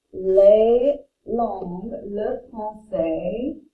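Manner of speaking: slowly, each French word spelled out in sound; teacher pacing French words at half speed; slow French demo. Shown as a demonstration. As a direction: model les langues, le français, l'espagnol slowly for the class. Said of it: "it blends in".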